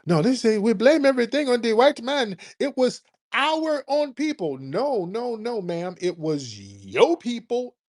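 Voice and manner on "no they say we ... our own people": higher, mimicking the caller's excuse; mocking the caller. Host mocks a caller's excuse, a higher mock voice. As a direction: parrot her words back in a mocking higher voice. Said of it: "Notice she came in low-key defending".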